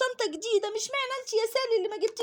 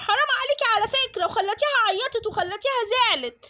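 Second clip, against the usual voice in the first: put-on indignant little girl; 3 dB louder than usual. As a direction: lecture her like a know-it-all kid.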